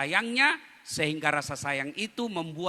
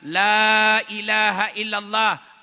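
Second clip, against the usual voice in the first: slow, drawn-out, louder recitation; guiding the dying; 5 semitones higher, 7 dB louder, much slower than usual. Acted. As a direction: coax him to repeat the words slowly. Bilal guides the dying man. (bimbingan lambat dan keras)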